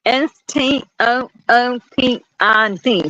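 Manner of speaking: slow letter by letter S T O O P I D; spelling stupid Southern style. Shown as a demonstration. Child spelling stupid the Southern way to teacher. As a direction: spell it out slowly with double O. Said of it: "spelled that the Southern way".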